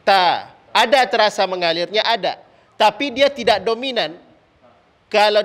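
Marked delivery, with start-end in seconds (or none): 0.0-0.5 ta with a light puff of breath; demo ta. Shown as a demonstration. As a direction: say ta into the palm, breath just felt. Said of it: "sebut huruf ta". (ta dengan hembusan ringan)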